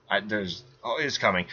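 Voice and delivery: usual speaking voice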